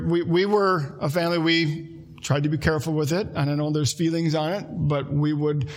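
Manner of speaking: usual speaking voice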